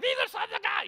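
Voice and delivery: squealed way up high; mock demon cry. Preacher mimics demons announcing Jesus, mock high cry. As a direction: mimic a pestering, high-pitched herald.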